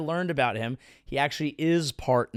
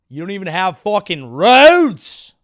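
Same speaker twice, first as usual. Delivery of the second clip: a big loud scoffing yell, leaning hard on "roads"; mock outraged yell; 5 semitones higher, 12 dB louder than usual. Mock outrage from an arrogant outsider at a country without roads. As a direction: bellow it like a snobbish outsider mocking the locals. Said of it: "and be like".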